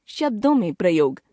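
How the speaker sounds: normal talking voice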